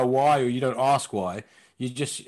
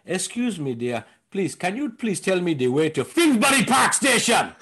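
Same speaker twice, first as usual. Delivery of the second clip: put-on African accent ending in a shout; 3 semitones higher than usual. African woman asking a stranger the way, sweet then shouting. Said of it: "why are you shouting at the end of it".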